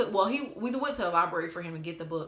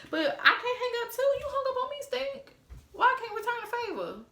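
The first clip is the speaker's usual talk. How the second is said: very high, squeaky put-on voice; mock whining protest. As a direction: protest in a silly sky-high voice.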